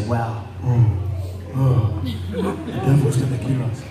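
low, hammed-up awe and alarm; mock dread. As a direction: imitate worried believers, pitched low. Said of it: "See, some of you say".

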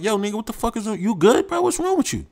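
high, soft teasing of a friend; mock concerned teasing. Friends needling the silent guy, high and teasing. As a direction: poke at him in a high mock-worried voice.

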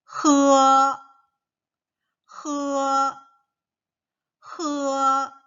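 high, loud 흐어, three times; model syllable repeated. Shown as a demonstration. Teacher drilling 흐어 with children. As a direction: breathe out 흐어 as a model. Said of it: "K가 아니겠죠".

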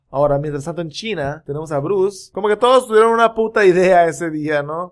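normal talking voice

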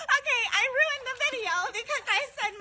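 high, fast, laughing through cold. Real, not acted. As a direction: giggle through the words. Laughing in ice water.

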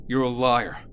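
furious character shouting liar; angry accusation. Acted. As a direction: hurl liar again and again.

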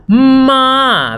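"Ma" called out high and loud; modeled syllable. Shown as a demonstration. Teacher calls out the syllable for children, loud. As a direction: belt out "Ma" for the kids. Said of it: "Suena ma".

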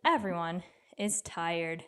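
plain reading voice

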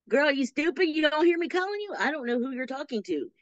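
high, scornful guard voice; guard sneering at an inmate; mocking guard. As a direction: belittle her like a guard who calls her stupid. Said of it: "they call me stupid".

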